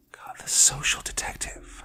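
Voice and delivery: breathy whisper to oneself. A narrator's reading. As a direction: whisper it to yourself. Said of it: "I whispered to myself".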